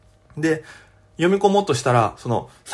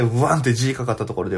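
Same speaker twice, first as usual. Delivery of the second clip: ordinary talk, nothing marked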